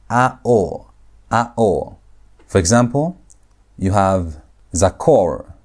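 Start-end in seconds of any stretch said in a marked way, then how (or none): 0.1-2.0 "a-o" as two distinct vowels, a then o; vowel sound demonstration. Shown as a demonstration. Teacher showing students the infinitive absolute's sound, demonstrating "a-o". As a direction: sound out "a-o" slowly as a vowel pattern. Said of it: "the sound that is created is".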